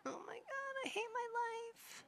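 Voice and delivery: high wailing melodramatic sim voice; dramatic whiny despair. Acted. Player voicing her crying sim, high melodramatic wail. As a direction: wail it like a tragic teen.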